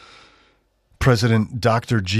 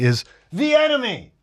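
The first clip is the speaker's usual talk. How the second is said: "is the enemy" squeaked much higher in mock disbelief; mock shrill repeat. Shown as a demonstration. Host repeating the post's phrase, squealing mockery. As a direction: squeal "is the enemy" as if it were absurd. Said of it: "I'm sorry".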